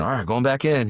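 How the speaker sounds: panting out a quick report between rounds; winded fit mom. Retold or imitated. CrossFit mom back from sparring, reporting breathlessly.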